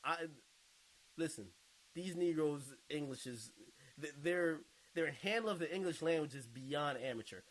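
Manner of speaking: usual speaking voice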